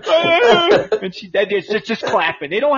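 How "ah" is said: loud high yelp of shock; mock startled yelp. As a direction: yelp like you got caught. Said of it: "He's like".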